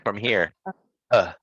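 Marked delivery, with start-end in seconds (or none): 1.1-1.3 uh as a short, relaxed grunt from the belly; uh, reduced vowel from low in the body; schwa from stomach. Shown as a demonstration. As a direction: demonstrate a brief central uh. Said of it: "more like from your, like, stomach".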